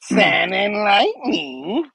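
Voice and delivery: Sandman. Retold or imitated. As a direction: draw each word out like a comic villain. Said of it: "And he's like".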